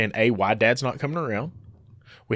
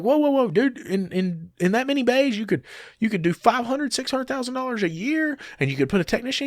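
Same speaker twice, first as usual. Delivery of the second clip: excited protest; 10 semitones higher than usual. Retold or imitated. Arguing with David Felton.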